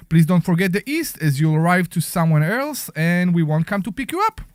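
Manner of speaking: ordinary delivery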